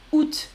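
août said "oot", final T sounded. Shown as a demonstration. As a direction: say août as one syllable, "oot". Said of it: "Moi, je dis août".

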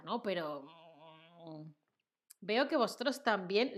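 ordinary talk

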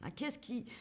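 ordinary talk, nothing marked

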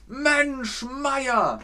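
high, stretched exclamation; exasperated exclamation. Shown as a demonstration. Twelve days in a jam, high exasperated cry. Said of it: "da kannst du sagen".

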